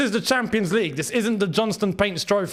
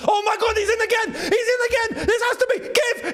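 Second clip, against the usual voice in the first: excited shout; 13 semitones higher than usual. Real, not acted. Fan shouting as his team scores again. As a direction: yell it out like your team just scored.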